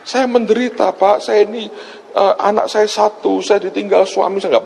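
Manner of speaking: high-pitched imitation of a distressed woman caller; imitated wailing plea. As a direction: plead high, as if calling out for help. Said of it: "dia teriak dengan saya". (menirukan ibu yang memohon dengan suara tinggi)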